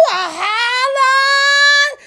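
very high, long drawn-out squeal; hammed-up wail, stretched right out; whooping holler. Host telling followers how hard they laughed, whooping holler. Exaggerated whoop over the funny story.

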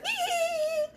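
high squealed imitation laugh; high-pitched fake tittering; mock laughing emoji. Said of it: "still send that bum ass laughing".

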